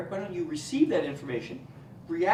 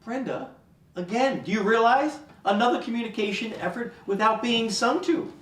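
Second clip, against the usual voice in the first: loud, emphatic excitement; thrilled praise; 4 semitones higher, 6 dB louder than usual. Acted. Therapist telling Brenda of a major breakthrough, loud and thrilled. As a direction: proclaim a breakthrough with rising excitement.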